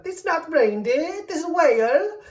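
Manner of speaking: mock Norwegian accent, drawn out. Retelling locals correcting her about whale, mock Norwegian accent. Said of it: "that's a good Norwegian accent".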